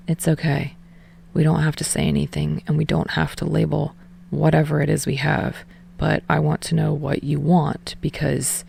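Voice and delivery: put-on low, soft, reassuring boy's voice; soft reassurance. Tsukishima calming Hinata, low soft reassurance. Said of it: "a soft, reassuring smile".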